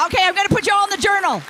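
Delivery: loud, quick and high; shouting over applause. Real, not acted. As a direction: call out over the clapping.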